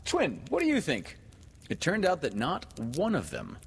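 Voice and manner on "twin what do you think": high-pitched eager question; eager boy. A narrator's reading. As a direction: ask it high, like an excited boy. Boys polling each other in story.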